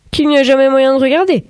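accusing. A narrator's reading. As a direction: cut in with a pointed grievance. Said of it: "accusa Euphémia". (ton accusateur, plus aigu)

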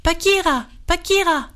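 high, falling call; storyteller's high-pitched summons, not shouted. A narrator's reading. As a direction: call the animal by name from afar. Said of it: "Il l'appela".

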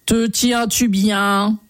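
slow, drawn-out anxious frog; worried frog. A narrator's reading. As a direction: ask slowly, a touch of worry. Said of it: "s'inquiéta-t-elle". (grenouille inquiète, lente)

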